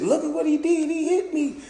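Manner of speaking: high falsetto whine; mock her showing off the bruise; mocking girl.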